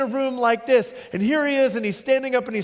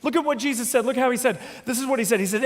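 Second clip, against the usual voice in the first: ordinary talk, nothing marked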